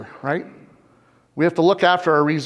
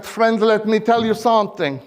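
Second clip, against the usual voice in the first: imitated Spanish accent, pitched up at first; put-on thick Spanish-accented English; 3 semitones higher than usual. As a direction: imitate a Chilean friend in a heavy Spanish accent. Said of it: "in this thick Spanish accent".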